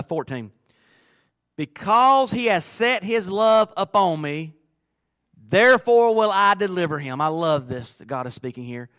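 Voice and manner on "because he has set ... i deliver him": slow, loud, high proclamation of God's promise; preaching at full force. Acted. Preacher reading God's promise to the church, booming. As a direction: call it out like God himself speaking.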